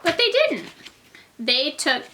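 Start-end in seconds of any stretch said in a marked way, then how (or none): 0.0-0.7 emphatic high. Acted. Stressed point to the children.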